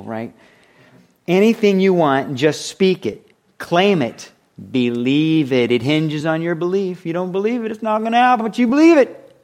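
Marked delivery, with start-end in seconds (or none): 1.3-9.4 mock preacher building higher and louder; hyper-faith preacher. Preacher parodying faith teachers' promises, rising fervor. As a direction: work it up into an over-the-top claim-it pitch.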